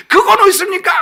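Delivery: very high, loud outraged question; protesting cry. Acted. Habakkuk argues with God again, outraged protest. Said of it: "또 막 항변하는 거예요".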